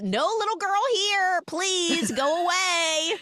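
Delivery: hammed-up high plea, drawn out at the end; mock voice behind door. Unseen man turning the girl away, imitated high and drawn out. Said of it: "And the voice says".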